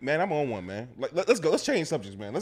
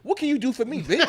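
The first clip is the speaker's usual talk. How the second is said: much higher, laughing pimp demand; hammed-up pimp voice; pimp act; 9 semitones higher than usual. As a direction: play the pimp, half-laughing. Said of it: "go on some pimp shit".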